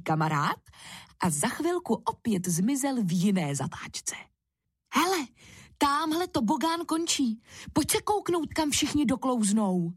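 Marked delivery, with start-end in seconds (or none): 4.9-9.9 put-on eager child suggesting. A narrator's reading. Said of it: "navrhl Ferda Kubovi".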